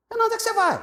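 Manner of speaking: high, loud teasing call; hammed-up buddy voice; mocking friend. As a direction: jeer at the new believer.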